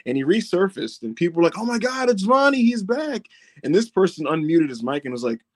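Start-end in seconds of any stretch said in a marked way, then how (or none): 1.6-3.3 high, thrilled gasp of recognition; higher excited chatter; excited crowd. Retold or imitated. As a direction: act like fans spotting a star. Crowd excited at Vonnie's return.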